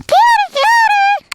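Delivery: high, loud urgent yell. Real, not acted. Kid yelling at helper to catch the cat, urgent high yell. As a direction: yell at them to grab it, now.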